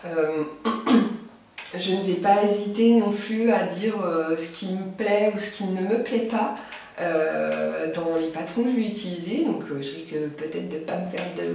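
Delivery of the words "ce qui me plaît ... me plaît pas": ordinary delivery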